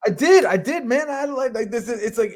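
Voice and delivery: high-pitched, loud defensive outburst; flustered protest. Real, not acted. Guest challenged on his unprepared list, flustered protest.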